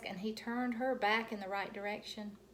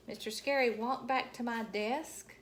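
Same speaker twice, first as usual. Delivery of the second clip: ordinary delivery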